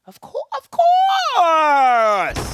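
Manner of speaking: long, slow, high shout; drawn-out shout. Real, not acted. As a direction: stretch it out and yell it.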